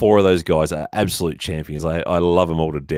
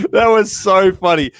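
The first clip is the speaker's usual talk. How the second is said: higher and louder, still laughing; slow and amused, riding the laugh; delighted, laughing it out; 12 semitones higher, 6 dB louder, slower than usual. Real, not acted.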